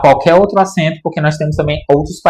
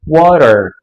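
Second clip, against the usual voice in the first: water with a flapped middle t and a curled R at the end; American English water; 4 dB louder than usual. Shown as a demonstration. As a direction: say water American style, every R sounded. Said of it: "no inglês americano, a gente vai pronunciar todos os R's".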